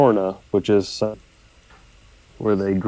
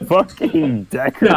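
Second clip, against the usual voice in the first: surprised; 10 semitones higher, slower than usual. Real, not acted. Player startled by rider appearing.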